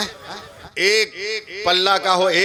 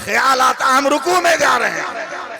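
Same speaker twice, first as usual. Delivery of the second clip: high, loud impassioned sermon cry; fiery preaching; 6 semitones higher, 3 dB louder than usual. Acted. Preacher rousing the crowd about prayer, shouting fervently. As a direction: build to a shouting climax.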